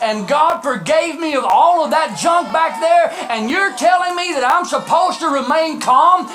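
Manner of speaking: high, loud, incredulous preacher; impassioned sermon shout; full-force preaching. Real, not acted. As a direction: fire off indignant rhetorical questions to the congregation.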